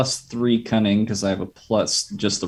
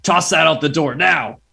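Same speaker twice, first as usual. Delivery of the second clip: raised, high-pitched command; in-character shout of alarm; urgent shouted order; 8 semitones higher, 3 dB louder than usual. Acted. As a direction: shout it across the room to get it thrown out. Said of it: "Wolf shouts to Reese".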